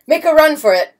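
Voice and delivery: loud, urgent command; urgent order. A narrator's reading. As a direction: bark the order to flee. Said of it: "ordered Las Bambas".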